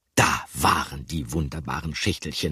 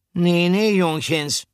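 much higher and louder, hearty; called out; 13 semitones higher, 6 dB louder, slower than usual. A narrator's reading. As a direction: wave the boys off with a call. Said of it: "rief Pomme de Terre".

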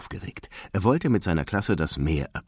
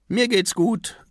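put-on high-pitched child's voice; little boy fish; 3 dB louder, slower than usual. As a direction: brush off Dad's worry in a high voice. Child Nemo reassures worried father. (hohe Kinderstimme)

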